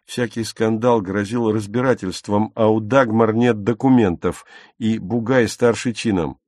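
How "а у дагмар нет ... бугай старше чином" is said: usual speaking voice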